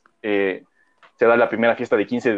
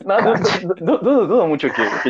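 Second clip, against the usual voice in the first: laughing denial; 8 semitones higher than usual. Real, not acted. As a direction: talk through laughter, higher pitched. Laughing denial after teasing dedication.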